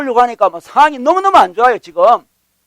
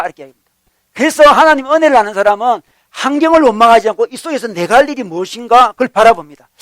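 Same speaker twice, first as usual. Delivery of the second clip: normal talking voice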